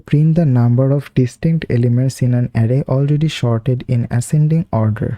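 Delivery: reading the English task very slowly, word by word; slow read-aloud. Real, not acted. Teacher reading the problem to viewers, very slow and deliberate. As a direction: spell out the problem statement slowly for students reading along.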